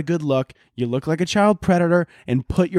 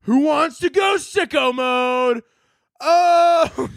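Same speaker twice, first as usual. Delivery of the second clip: high, slow hyped rapper shout; 10 semitones higher, much slower than usual. Retold or imitated. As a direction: hype the crowd like a rapper on the mic. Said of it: "he gets on the mic and he's like".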